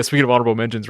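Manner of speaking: ordinary talk, nothing marked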